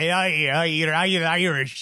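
put-on Irish accent drifting into a pirate growl; piratey Irish accent. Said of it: "now you're a pirate".

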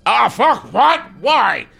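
very high, loud squawking protest; Scorpo's outburst. Acted. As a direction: screech each word in shocked complaint. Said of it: "Scorpo, first of all, goes".

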